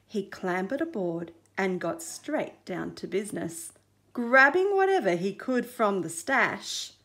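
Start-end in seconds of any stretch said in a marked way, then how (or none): none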